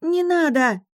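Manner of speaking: high desperate yell. A narrator's reading. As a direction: scream it out with all your strength. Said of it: "заорала я".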